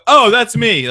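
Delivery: loud, high announcement; real loud. Retold or imitated. Man finally called after hours, announcing loudly to the room. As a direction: say it so the whole room knows. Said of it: "real loud".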